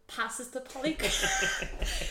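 ordinary delivery

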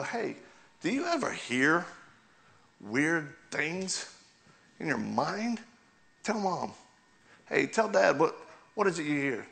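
lightly voiced parent asking the kids; parent to child. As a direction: coax a child to open up. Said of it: "Parents, you gotta sit down with your kids and go".

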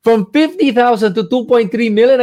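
high-pitched incredulous question; retold disbelief. Friend questioning the advice after a huge gain, in acted disbelief. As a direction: mimic a friend's astonished double-check.

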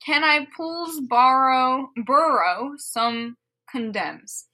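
usual speaking voice

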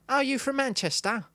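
high-pitched caricature Cockney question; cartoon cockney. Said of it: "he's a cartoon cockney".

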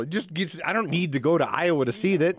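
high and loud, fired up; excited. Real, not acted. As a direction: riff it with rising excitement. Excited riffing on gig.

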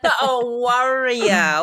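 "warrior" said slowly, each syllable stretched; drawn-out warrior. Shown as a demonstration. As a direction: spell out "warrior" slowly, not "worrier". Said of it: "warrior with a W O".

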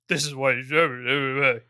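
slow mock impression; imitating the player. GM mocks the player by slowly imitating him. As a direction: slowly parrot the player's voice back. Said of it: "That's what you sound like".

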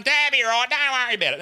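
high-pitched acted reassurance; mate brushing off danger. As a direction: mimic your mate waving away the worry.

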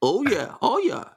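high put-on Fargo accent. Playing a Fargo guest who answers the host, Fargo accent. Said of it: "Oh, I'm from Fargo".

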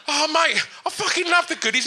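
high, gushing fan praise; high breathless adoration. Retold or imitated. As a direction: gush at the worn-out celebrity. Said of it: "I've just gone".

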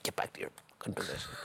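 low, voiceless urgent scolding; quick whispered telling-off; hushed retold order. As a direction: hiss the order under your breath. Teammate grabs and scolds him.

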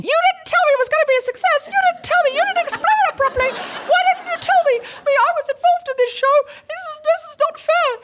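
squeaky, fast petulant whine of a small man left behind; whiny high-pitched complaint. Retold or imitated. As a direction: whine it like a tiny man chasing the chariot. Ex-collaborator whining, chasing chariot.

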